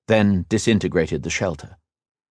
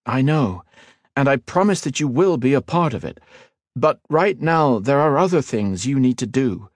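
put-on raised, earnest young hero; 7 semitones higher than usual. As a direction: explain it gravely and kindly. Ethan explains gravely.

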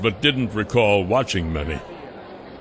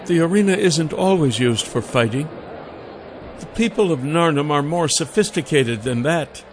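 higher woman's voice; Celine voice; 4 semitones higher than usual. A narrator's reading. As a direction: voice the lady higher. Celine explaining.

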